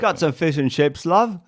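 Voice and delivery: high cheeky Cockney-ish voice; mock London chap; British accent. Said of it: "I suck at it".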